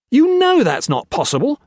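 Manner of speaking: very high, loud protest; frantic protest. A narrator's reading. Gray protests the missing diamond, very high and loud. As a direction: protest in disbelief.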